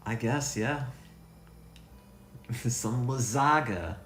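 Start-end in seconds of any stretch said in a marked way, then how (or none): 2.5-4.0 "lasagna" said luh-ZAH-guh, with a hard g; silly mispronounced lasagna. Pretend. Repeats the joke mispronunciation.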